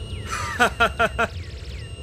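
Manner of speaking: breathy confident laugh; soft airy chuckle. Acted. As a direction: laugh off the question. Confident laugh after boast.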